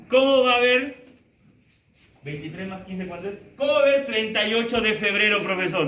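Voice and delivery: incredulous student pointing out a mistake; mock student objection. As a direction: play the smug student catching the teacher out. Student mocking teacher's error.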